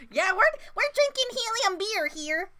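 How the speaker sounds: high squeaky helium voice. Pretend. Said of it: "pitch our voices upward for this section".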